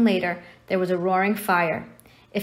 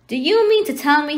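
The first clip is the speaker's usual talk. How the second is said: raised, loud indignant growl; incensed giant confronting them; angry growl; 7 semitones higher, 6 dB louder than usual. A narrator's reading. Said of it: "he growled at Dursleys".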